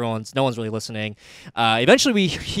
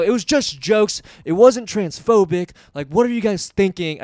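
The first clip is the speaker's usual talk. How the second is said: higher, louder imitation of a ranting comic; protesting, stretched-out words, pitched up; retold indignant rant; 6 semitones higher, 5 dB louder than usual. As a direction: scold the room in a mocking raised voice. Retells Joe's angry rant at audience.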